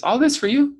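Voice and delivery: high-pitched questioning retold voice; surprised question. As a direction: imitate a stranger asking in surprise, pitched well up. Stranger's question, acted in retelling.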